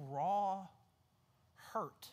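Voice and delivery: ordinary talk, nothing marked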